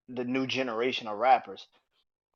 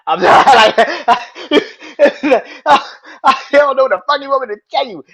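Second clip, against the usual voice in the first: high, loud laughing disbelief; 13 semitones higher, 14 dB louder than usual. Real, not acted. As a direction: crack up in helpless awe. Laughing over [name]'s verse.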